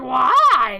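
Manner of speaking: loud, drawn-out cry of disbelief; hammed-up demand for an explanation; mock wail. Girl mimics people pressing her, wailing in mock disbelief.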